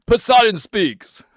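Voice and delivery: hammed-up divine decree; mock god voice. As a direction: announce it like a sea god.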